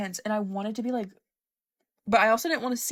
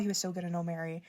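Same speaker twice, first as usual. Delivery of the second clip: quiet repeat; 3 semitones lower, 7 dB quieter, faster than usual. Real, not acted.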